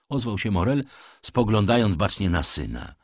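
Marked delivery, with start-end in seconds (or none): none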